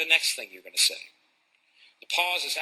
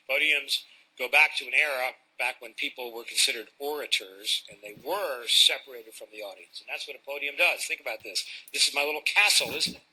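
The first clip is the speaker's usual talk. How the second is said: ordinary delivery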